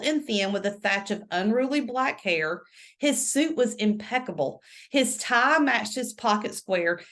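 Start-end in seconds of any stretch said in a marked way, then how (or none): none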